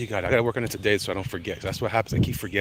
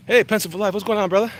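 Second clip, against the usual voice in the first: excited greeting; 11 semitones higher, 8 dB louder, faster than usual. Real, not acted. Greeting a chat viewer loudly.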